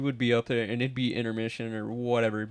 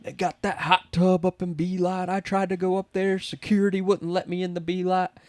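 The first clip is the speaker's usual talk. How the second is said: tipsy announcer voice; 7 semitones higher than usual. Retold or imitated. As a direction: egg the crowd on from the tower. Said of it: "and he's like".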